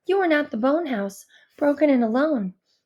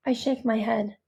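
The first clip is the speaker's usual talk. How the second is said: usual speaking voice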